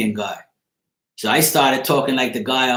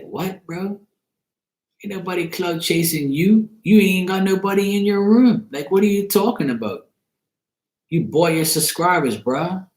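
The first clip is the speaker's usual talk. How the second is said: higher, disbelieving put-on voice; mock incredulous retort; 8 semitones higher than usual. Narrator retelling his scoffing reply to the streamer, mock disbelief. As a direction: scoff at him like it's absurd. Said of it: "I was like".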